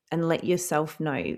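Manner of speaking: usual speaking voice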